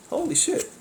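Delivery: a startled falsetto gasp of words; mock astonishment. After the traffic parenting example, squealing in mock astonishment.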